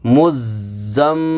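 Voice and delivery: मुज़्ज़म्मी with the m held long in the nose; nasal ghunna. Shown as a demonstration. Teacher shows pupils the ghunna, मुज़्ज़म्मी with long nasal m. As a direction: hum the doubled m of मुज़्ज़म्मी before releasing. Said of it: "यहाँ पर गुन्ना भी होगा".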